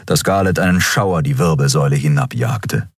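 ordinary delivery

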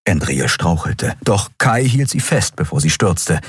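usual speaking voice

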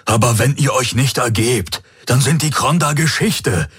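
dark-timbred man, urgent appeal; Hawks, earnest pleader. A narrator's reading. Hawks urging Tari to surrender, earnest plea. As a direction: plead earnestly with the enemy guard.

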